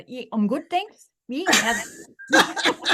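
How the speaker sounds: broad Australian, vowels swallowed, slow drawl at the end; laughing Australian accent lesson, thanks flipped high; swallowed Aussie vowels. Shown as a demonstration. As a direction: demonstrate the real Aussie twang, vowels squashed. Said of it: "You kind of gotta swallow the vowels".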